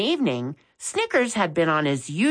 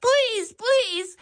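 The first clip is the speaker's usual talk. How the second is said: very high, desperate pleading voice; woman on a bench begging them to remove the rat; pleading scream. A narrator's reading. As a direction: beg in a high frantic voice. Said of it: "standing on the piano bench screaming".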